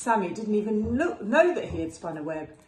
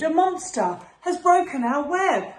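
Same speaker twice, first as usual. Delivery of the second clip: frightened cry; 8 semitones higher, 5 dB louder than usual. Acted. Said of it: "he screamed".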